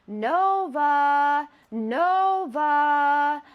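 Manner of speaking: a voice starting to turn wrong; changing call. Retold or imitated. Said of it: "Voice is changing a little bit".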